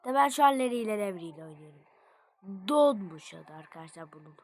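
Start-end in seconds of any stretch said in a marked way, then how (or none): none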